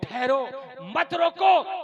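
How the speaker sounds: loud, high, drawn-out command; calling a halt. Retold or imitated. Prophet tells companions not to interrupt, calling a halt. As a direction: call out to stop them, stretched slow.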